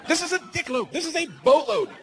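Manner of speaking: high-pitched, escalating outrage; exasperated rant. Acted. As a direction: rant at your wife in high-voiced disbelief. Acted rant at wife.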